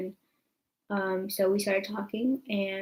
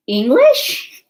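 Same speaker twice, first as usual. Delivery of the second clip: much louder and higher astonished question; shocked; 4 semitones higher, 12 dB louder than usual. Retold or imitated. Reacting to boyfriend speaking English, loud high astonished question. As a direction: jolt at the surprise.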